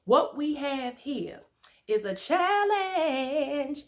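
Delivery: slow sung announcement; dramatic announcement. Real, not acted. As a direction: stretch it out like a showman.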